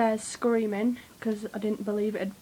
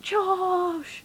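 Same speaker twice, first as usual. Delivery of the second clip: fan scream; 9 semitones higher than usual. Retold or imitated. Crowd screaming a band member's name.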